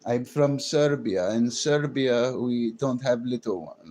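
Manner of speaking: slow imitated Serbian speaker; mock Serbian accent. Streamer playing a Serbian woman, mock Serbian accent. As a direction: slowly ham up a Serbian accent. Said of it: "Well, Serbian women, too".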